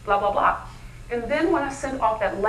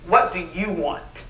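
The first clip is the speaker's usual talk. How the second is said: lower, slow, mocking a mother's cold demand; 6 semitones lower, slower than usual. Mother answering her daughter's call, cold and grudging. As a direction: drag it out like you don't want this call. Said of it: "when you hear that tone".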